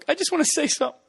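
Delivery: high-pitched fake emotional wobble; mock choked up. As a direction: act like you're about to cry. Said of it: "he gets a little emotional".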